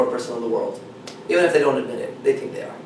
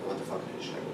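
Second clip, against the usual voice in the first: muttered inner voice; 9 semitones lower, 18 dB quieter, faster than usual. Retold or imitated. Voicing the anxious inner voice.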